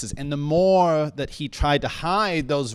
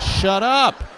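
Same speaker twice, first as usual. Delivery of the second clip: dad's shout; 6 semitones higher, 7 dB louder than usual. Retold or imitated. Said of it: "He said".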